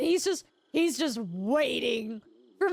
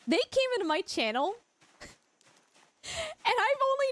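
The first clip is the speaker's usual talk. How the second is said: ordinary talk, nothing marked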